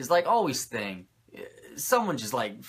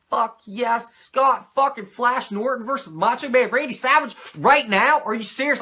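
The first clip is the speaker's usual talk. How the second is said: excited fan; 11 semitones higher, 10 dB louder than usual. Retold or imitated.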